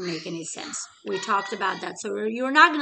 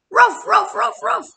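much higher, much louder barking; fierce dog barks; 11 semitones higher, 11 dB louder than usual. Acted. Dog answering Middle Chick, loud high fierce barking. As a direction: bark back hard like an angry dog.

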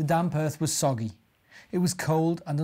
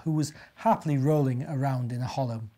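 usual speaking voice

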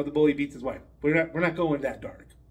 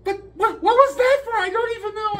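put-on much higher, bewildered child's voice; hurt little boy; 4 dB louder than usual. Jimmy asking the teacher why she slapped him, high child voice. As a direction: squeak out like a stunned kindergartner.

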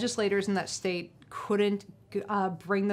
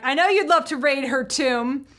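retold yell, raised and loud; yelling back; 5 semitones higher, 6 dB louder than usual. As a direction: call it back like a heckle. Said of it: "I, I yell, le-, yell back".

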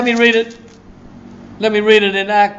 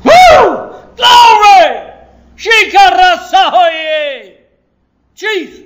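high, loud ecstatic praise shout; 12 semitones higher, 8 dB louder, slower than usual. Real, not acted. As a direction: let it burst out in joyful glory. Preacher erupts in ecstatic praise.

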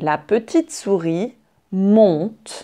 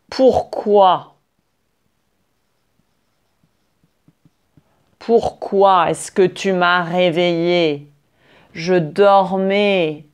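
big booming lion voice. Acted. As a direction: roar it out like a furious lion. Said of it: "d'une grosse voix". (grosse voix de lion furieux)

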